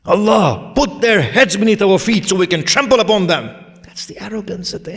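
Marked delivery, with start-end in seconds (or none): 0.0-3.5 high, loud sermon delivery; full-force preaching. Acted. Preacher tells of the boastful burning in hell, full-force preaching. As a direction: cry out the pleas of the damned.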